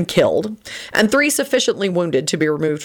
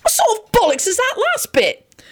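high-pitched indignant outburst; shouting. A narrator's reading. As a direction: protest in a high, outraged voice. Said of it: "Sirius shouted".

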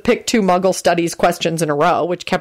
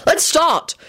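high-pitched impatient urging; 11 semitones higher than usual. A narrator's reading. George hurrying the players to begin the game, impatient. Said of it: "George said impatiently".

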